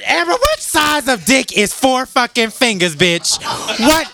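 high-pitched, worked-up rant; yelling. Real, not acted. Telling off a co-host over four fingers, yelling high and indignant. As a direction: yell it in exasperated disbelief. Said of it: "Why are you yelling at me?".

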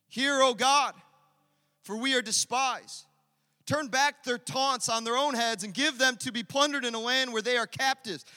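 loud, high, fervent cry to God; bold prayer. Acted. Nehemiah praying against his mockers, loud bold prayer. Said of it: "Nehemiah prays a bold prayer".

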